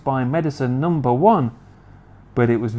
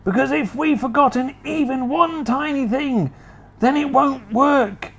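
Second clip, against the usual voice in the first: high, pressing and urgent; anxious Mister Cranky; 11 semitones higher than usual. Acted. Mister Cranky pressing George to tell him quick, anxious. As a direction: hurry him, fretting.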